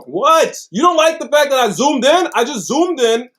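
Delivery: loud, high-pitched yelling. Real, not acted. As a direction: yell it back, pitch jumping way up. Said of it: "I'm yelling".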